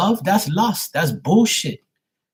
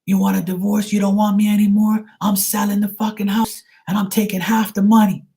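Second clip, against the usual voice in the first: much higher, put-on whiny spiteful voice; mocking the brother-in-law; 8 semitones higher than usual. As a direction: sneer it like a spiteful ex-husband. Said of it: "And he says".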